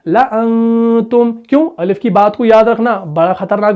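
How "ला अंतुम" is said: ला अंतुम recited slowly, the alif silent; slow reciting of ला अंतुम; correct recitation. Shown as a demonstration. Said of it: "ला अंतुम नहीं करेंगे".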